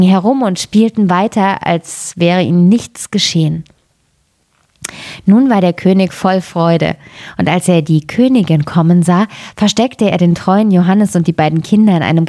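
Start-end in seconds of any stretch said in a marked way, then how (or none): none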